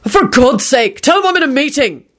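high, annoyed boss; exasperated. A narrator's reading. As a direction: order the secretary off in exasperation. Boss snaps into the intercom.

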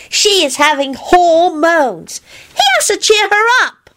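put-on very high, slow childish announcement; self-important child. A narrator's reading. Teddy explains to Kingsley about his aunt, self-important. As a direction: pronounce it slowly in a high kiddie voice. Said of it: "Teddy said importantly".

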